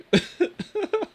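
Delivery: very high rising laugh. Real, not acted. Streamer laughing at her own crying claim, high squeal.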